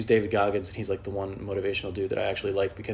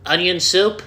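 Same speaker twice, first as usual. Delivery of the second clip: higher, louder blunt query; rude customer; 9 semitones higher, 9 dB louder, slower than usual. Retold or imitated. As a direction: bark it like a shopper who skips hello. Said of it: "they're like".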